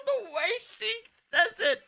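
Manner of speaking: high, laughing through the words; cracking up mid-sentence. Real, not acted. Laughing hard at clip.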